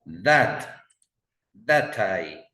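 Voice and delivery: that said loud and clear; stress on that. Shown as a demonstration. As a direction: punch the word that in That tie. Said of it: "Él dice that". (that dicho con fuerza)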